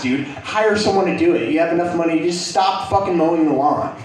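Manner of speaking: ordinary delivery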